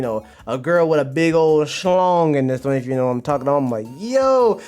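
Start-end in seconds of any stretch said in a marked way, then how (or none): 4.0-4.6 high, loud, stretched "yo"; shocked exclamation. Retold or imitated. Streamer reacting to a surprise in the manga, astonished. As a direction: blurt it in mock astonishment.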